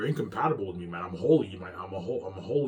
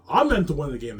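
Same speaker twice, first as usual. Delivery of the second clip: raised, emphatic declaration; loud insistence; 6 semitones higher, 11 dB louder than usual. Real, not acted. Proclaiming his destiny to the world, loudly.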